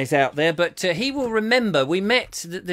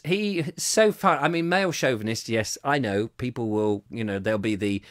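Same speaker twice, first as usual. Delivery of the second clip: usual speaking voice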